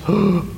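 sharp startled intake of breath; a short, quiet shocked gasp; caught-out gasp. Acted. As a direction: gasp like you've just been caught. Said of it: "And Johnny goes".

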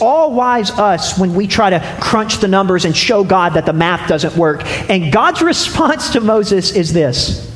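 ordinary delivery

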